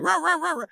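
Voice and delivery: high yappy imitated barks; playful dog bark imitation; mock barking. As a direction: bark like a small dog chasing a donkey.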